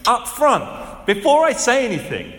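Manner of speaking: voicing Jesus. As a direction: proclaim it as Jesus with full authority. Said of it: "Jesus is saying".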